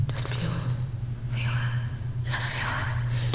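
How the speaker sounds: very soft, drawn-out whisper; slow whisper. Pretend. Coaxing the listener to feel it, slow whisper.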